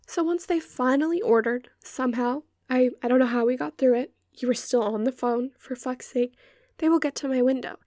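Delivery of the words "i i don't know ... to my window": ordinary talk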